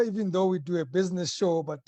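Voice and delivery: ordinary talk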